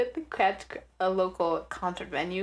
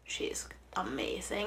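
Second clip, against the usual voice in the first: whispering; 9 dB quieter, slower than usual. Real, not acted. Gushing about comedian.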